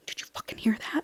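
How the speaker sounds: hushed, voiceless whisper of a secret question; retold whisper. Heroine secretly asks companion about the mysterious voice, whispering. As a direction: whisper it as if someone might overhear.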